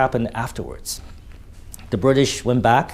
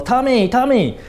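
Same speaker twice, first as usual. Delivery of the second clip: high, loud call across the lines; 12 semitones higher, 3 dB louder than usual. Retold or imitated. German soldiers calling over to the British trenches, high loud call. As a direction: shout it across no-man's-land.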